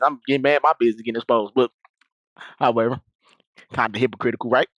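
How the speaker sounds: ordinary talk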